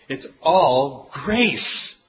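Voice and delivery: ordinary talk, nothing marked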